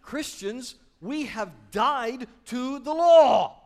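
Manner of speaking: loud, high, slow proclamation; full-force preacher declaring; preaching. Acted. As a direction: thunder it out to the congregation.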